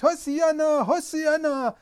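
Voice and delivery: high, loud rejoicing shout of Hosianna; jubilant shout. Retold or imitated. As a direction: cry it out like a dancing, rejoicing man. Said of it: "und jubelt die ganze Zeit".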